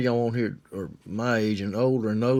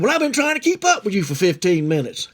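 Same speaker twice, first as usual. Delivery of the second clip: high-pitched, pleading retort; exasperated protest; 7 semitones higher, 3 dB louder than usual. Retold or imitated. Boy answering the old man he was following, high protest. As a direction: protest back in a raised pitch.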